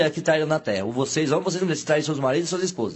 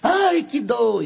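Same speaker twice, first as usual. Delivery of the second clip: slow, higher pained wailing; drawn-out higher cries of pain; mock labor cries; 8 semitones higher, much slower than usual. A narrator's reading. Woman in labor crying out in mock pain.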